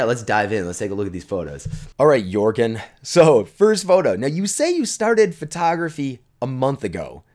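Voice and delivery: normal talking voice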